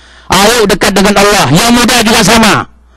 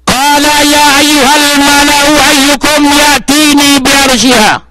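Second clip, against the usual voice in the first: slow, high chanted Arabic recitation; Quran recitation; 6 semitones higher, slower than usual. Real, not acted. Preacher recites the Quran verse, slow chant.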